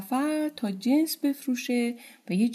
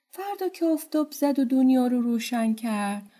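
plain reading voice

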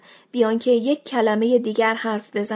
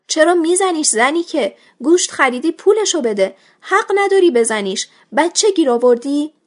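higher-pitched reproach from an onlooker; indignant scolding; 5 semitones higher, 4 dB louder than usual. Acted. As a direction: step in and tell someone off, voice raised. Bystanders scold someone for hitting. (با اعتراض و سرزنش، صدای بالا)

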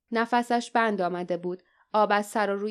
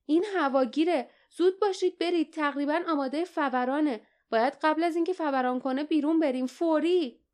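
high, pressing command; urgent warning; 7 semitones higher than usual. A narrator's reading. Barda urges companions out before eruption, high urgent voice. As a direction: hurry them on in a raised pitch.

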